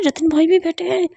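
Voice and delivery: ordinary delivery, nothing marked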